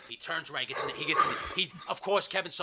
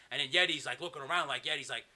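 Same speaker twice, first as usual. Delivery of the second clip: ordinary talk, nothing marked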